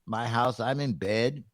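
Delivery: usual speaking voice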